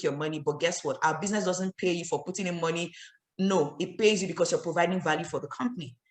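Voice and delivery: low, soft, professional reply; calm. Shown as a demonstration. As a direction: calmly reassure a doubtful prospect. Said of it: "I'll talk calmly".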